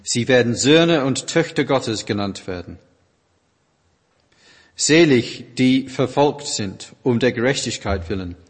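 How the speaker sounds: ordinary talk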